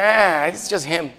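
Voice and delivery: slow, laughing, unimpressed; slow and laughing, shrug him off; mock bored wife. A wife teased for ignoring his homecoming, slow and unimpressed. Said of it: "I get home and she's over there".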